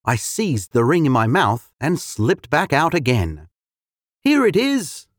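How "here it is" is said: much higher, small mouse voice; squeaky mouse. A narrator's reading. Mouse handing the ring to the cat, squeaky high. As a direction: hand it over timidly. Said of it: "I said to the cat".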